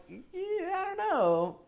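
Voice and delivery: high mock shrug voice. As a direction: squeak it out dismissively. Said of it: "It's kinda like".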